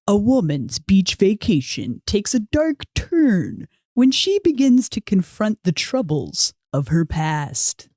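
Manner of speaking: slow, dramatic trailer announcer; movie trailer voice. Shown as a demonstration. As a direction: read it like an epic movie trailer. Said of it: "like, a trailer voice?".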